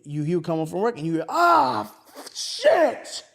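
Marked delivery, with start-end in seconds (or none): 1.3-3.2 high-pitched mock scream; imitating a scream. Stepdad coming home from work, imitated screaming. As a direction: yell it like the stepdad, not full volume. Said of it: "he would scream".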